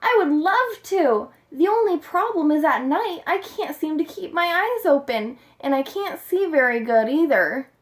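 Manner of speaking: high, eager owl voice, well above the narrator; a young owl talking to God, high and eager; owl's voice. A narrator's reading. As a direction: voice the owl keen to help. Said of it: "He said".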